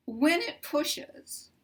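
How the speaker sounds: ordinary talk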